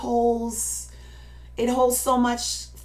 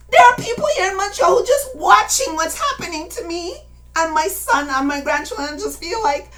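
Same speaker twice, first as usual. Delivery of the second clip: loud, high, impassioned exhortation; very emotional; 5 semitones higher, 8 dB louder than usual. Real, not acted. Speaker pleading with folks watching what happens to family, very emotional. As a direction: cry out to the people, voice swelling with real emotion. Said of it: "I'm very emotional".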